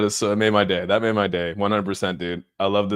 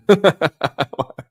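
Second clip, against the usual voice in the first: loud high laugh; 8 semitones higher, 5 dB louder than usual. Real, not acted. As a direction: crack up at the joke. Streamer laughing at chat.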